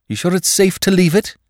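worried; anxious. A narrator's reading. Feverish master anxious.

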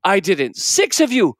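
a put-upon uncle ranting at the whole household; exasperated uncle. Acted. Uncle rants about his lost coat.

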